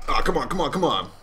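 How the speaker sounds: ordinary talk, nothing marked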